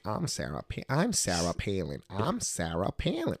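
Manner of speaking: practising a politician's voice, building attempt by attempt; Sarah Palin impression. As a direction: try the voice on, repeating to hit it. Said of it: "trying to copy the voice".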